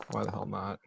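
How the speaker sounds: normal talking voice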